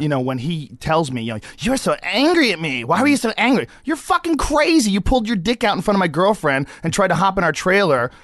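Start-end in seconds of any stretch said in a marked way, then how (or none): 1.6-3.7 mock aggrieved complaint, high and loud; Andy Dick. Mimicking a former co-star accusing him of anger, mock aggrieved. 3.8-8.1 high, loud, indignant comeback; exasperated retort. Retold or imitated. Answering a co-star's accusation over his wild behavior, exasperated retort.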